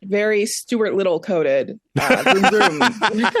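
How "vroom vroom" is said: mock revving car noise; imitated engine vroom. Critic capping a jibe at the racing film, imitated vroom. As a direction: rev it out like a race car.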